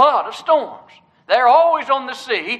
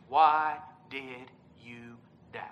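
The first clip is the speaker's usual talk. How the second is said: low, soft and drawn out word by word; slow, hushed quote; 10 semitones lower, 9 dB quieter, slower than usual. Retold or imitated. Preacher dwelling on Jesus' question to Peter, slow and hushed. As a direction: let each word sink in, slowly and quietly.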